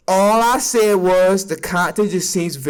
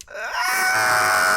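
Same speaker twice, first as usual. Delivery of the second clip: sudden low, rough cry of pain; a hammed-up low yelp; low mock scream; 12 semitones lower, 3 dB quieter than usual. Kid getting whipped in the skit, low mock scream.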